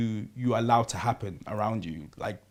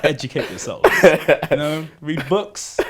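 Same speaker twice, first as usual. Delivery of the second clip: laughing; 3 semitones higher, 7 dB louder than usual. Real, not acted. Laughing call to the audience.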